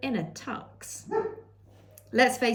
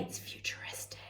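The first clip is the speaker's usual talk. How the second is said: whispered aside; 15 dB quieter than usual. Real, not acted.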